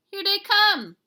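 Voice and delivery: very high, loud warning; alarmed shout. A narrator's reading. Kitty boy warning his friends, high loud shout.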